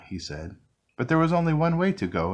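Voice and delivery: usual speaking voice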